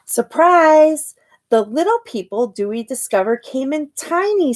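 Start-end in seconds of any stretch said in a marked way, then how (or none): 0.1-1.1 loud, high surprise; spring the reveal on the kids; surprised. A narrator's reading. Reader revealing the baby to the children, loud and high.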